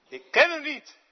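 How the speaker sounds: high, loud, defiant cry; loud denial. Acted. Peter denying Jesus before the crowd, loud defiant. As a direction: fling out the denial.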